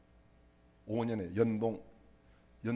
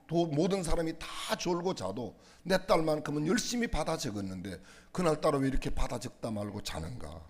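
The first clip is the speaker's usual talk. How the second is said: usual speaking voice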